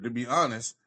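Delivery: normal talking voice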